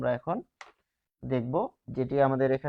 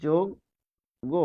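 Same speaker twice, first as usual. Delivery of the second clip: যজ্ঞ very slowly as "jog ... go"; long pause inside যজ্ঞ, between jog and go; syllable-split pronunciation; much slower than usual. Shown as a demonstration. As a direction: break যজ্ঞ into two separate syllables. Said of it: "কেন এরকম হলো".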